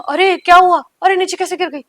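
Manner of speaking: put-on panicked cry to a girl who fell from the bed; alarmed cry. Woken by the girl falling.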